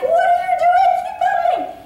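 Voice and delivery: very high, squealed mock panic; frantic yelling. Sister to brother who stopped fleeing shark, hammed-up frantic shriek. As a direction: shriek it in comic desperation.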